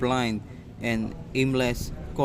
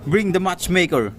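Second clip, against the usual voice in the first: raised, higher voice barking an order; loud command; 6 semitones higher, 5 dB louder than usual. Acted. Judge commanding the court to fetch the matchmaker, loud. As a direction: summon the matchmaker with authority.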